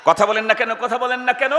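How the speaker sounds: ordinary delivery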